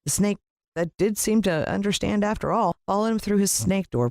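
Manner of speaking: ordinary delivery, nothing marked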